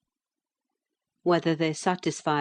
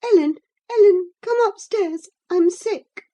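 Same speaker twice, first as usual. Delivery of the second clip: put-on high, loud pleading girl with a cry in it; desperate young girl; 8 dB louder than usual. A narrator's reading. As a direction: call for help, near tears. Frightened girl calls her nurse.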